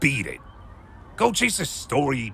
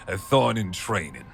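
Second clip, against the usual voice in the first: ordinary talk, nothing marked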